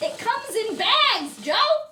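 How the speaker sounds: slow, loud, high it comes in bags; exasperated shout. Acted. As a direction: hammer it home in exasperation. Exasperated louder repeat.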